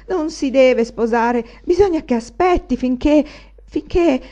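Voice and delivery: più acuto e più forte, concitato; si tormenta, sempre più acceso; angoscia gelosa. Acted. Anania geloso, disperato. (Anania geloso si agita, acuto e forte)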